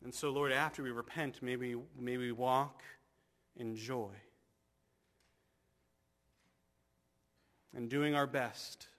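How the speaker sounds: fervent, steady pastoral prayer; earnest prayer. Acted. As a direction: pray over the congregation. Closing prayer.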